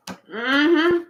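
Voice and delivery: normal talking voice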